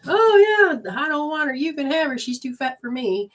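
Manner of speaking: hammed-up dismissive song voice; mocking the song lyric. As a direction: toss off the lyric like a cocky singer. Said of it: "that's the other thing about, uh, songs".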